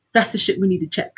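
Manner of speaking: ordinary talk, nothing marked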